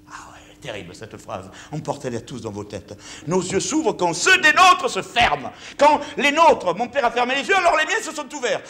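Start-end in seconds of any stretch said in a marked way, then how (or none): 0.1-1.5 whisper. Real, not acted. Awed aside after quoting. 3.3-8.6 the same line again, much higher and louder; soaring preacher's declaration; ringing proclamation. Shown as a demonstration. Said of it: "mon père avait écrit cette phrase".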